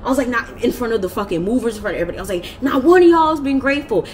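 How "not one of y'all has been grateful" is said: higher, louder, accusing; going off. Retold or imitated. Going off at her family during the move, high and loud. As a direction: throw it at them all. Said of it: "I started going off".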